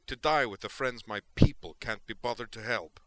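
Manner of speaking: loud, bitter parting shot; defiant and heated; snapped retort. A narrator's reading. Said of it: "Tali snapped".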